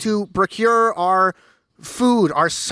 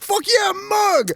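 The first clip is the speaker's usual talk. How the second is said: high, hyped-up cheer; excited shout; 13 semitones higher, slower than usual. Retold or imitated. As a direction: yell it like a pumped fan. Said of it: "we're like".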